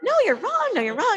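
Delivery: high, sing-song mock contradiction; retold disagreement. Woman at the bar disputes the speaker, high mock insistence. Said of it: "So we were disagreeing".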